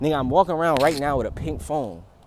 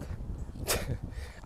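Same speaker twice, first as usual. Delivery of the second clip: breathy laugh; 12 dB quieter than usual. Real, not acted. Laughing at himself.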